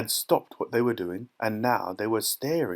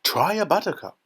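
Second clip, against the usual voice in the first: raised pitch, keen invitation; eager cry; 7 semitones higher, 4 dB louder than usual. A narrator's reading. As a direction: call it out with lifted enthusiasm. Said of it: "cried Mister Wonka".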